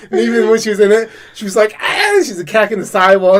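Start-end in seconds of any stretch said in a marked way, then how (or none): 1.8-2.0 sharp cat screech; imitated cat yowl. Owner mimicking the cat attacking the cage wall, screech. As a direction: yowl like a caged cat lashing out.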